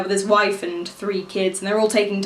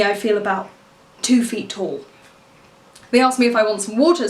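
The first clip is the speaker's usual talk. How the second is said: usual speaking voice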